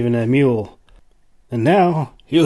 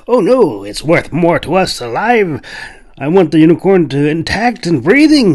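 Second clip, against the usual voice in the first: higher, louder, firm insistence; insisting hunter; 5 semitones higher, 5 dB louder than usual. A narrator's reading. Hunter insisting the unicorn stays alive, firm. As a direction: press the point like a greedy hunter. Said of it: "he insisted".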